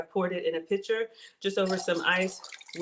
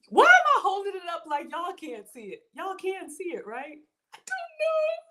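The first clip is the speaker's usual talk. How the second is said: flustered squeal; 10 semitones higher than usual. Real, not acted. Flustered by donations.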